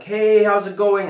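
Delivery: stoned overfriendly hello; overfriendly greeting. Retold or imitated. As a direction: greet the mom way too happily. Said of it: "I'm like".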